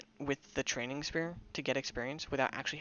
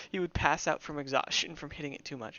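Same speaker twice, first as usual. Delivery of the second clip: laughing hard; 12 dB louder, slower than usual. Real, not acted. Laughing at spear dwarf scene.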